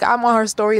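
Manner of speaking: ordinary talk, nothing marked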